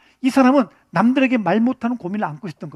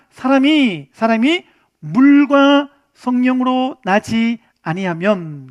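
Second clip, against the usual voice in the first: slow emphatic quote; 3 dB louder, much slower than usual. Shown as a demonstration. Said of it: "이렇게 말합니다".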